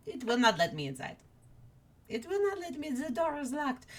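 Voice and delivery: small high upset character voice, quick at first; Chess. Acted. Chess complains to worm about the locked door, high upset voice. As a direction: climb into a soft high mock whine. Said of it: "And Chess is like".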